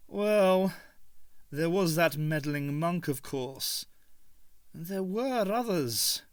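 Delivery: slow, lightly voiced musing Doctor; the Doctor brooding to Ace. A narrator's reading. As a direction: ponder old enemies darkly.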